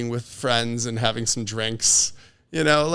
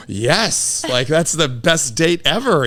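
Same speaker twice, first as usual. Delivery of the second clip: delighted yes; 2 semitones higher, 4 dB louder, slower than usual. Retold or imitated. Thrilled at the date question.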